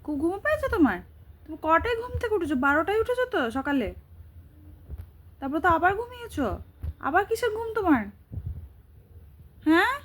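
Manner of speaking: high, teasing sing-song voice. Real, not acted. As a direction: coo at a sleepy pet. Teasing the sleeper.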